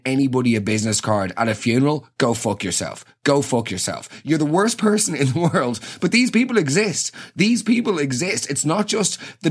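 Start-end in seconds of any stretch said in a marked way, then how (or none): none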